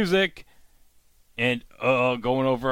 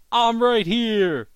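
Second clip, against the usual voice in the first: high, loud and slow hero's declaration; heroic entrance line; 9 semitones higher, 4 dB louder, slower than usual. Retold or imitated. Arthur lands and announces himself to the siren, slow heroic declaration. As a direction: land the line like a movie hero.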